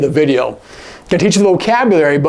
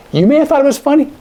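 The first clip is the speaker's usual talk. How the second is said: mocking aside; 11 semitones higher, much faster than usual. Shown as a demonstration. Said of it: "my face is telling you".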